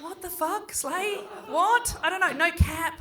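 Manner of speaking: high, incredulous bewildered questions; baffled confusion. Retold or imitated. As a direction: sound utterly lost at the slang. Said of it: "You're like".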